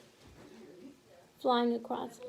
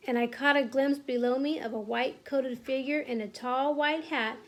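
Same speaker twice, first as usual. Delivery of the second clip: ordinary delivery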